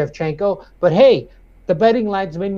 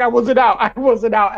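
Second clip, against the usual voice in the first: insistent denial; 6 semitones higher, 4 dB louder than usual. Retold or imitated. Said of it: "He's like".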